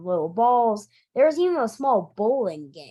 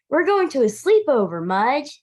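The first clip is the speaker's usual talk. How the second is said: quick, louder, higher excited announcement; 6 semitones higher, 5 dB louder than usual. Acted. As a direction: tell the dog the big news, bursting with it. Henry tells dog excitedly.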